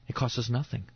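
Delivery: ordinary talk, nothing marked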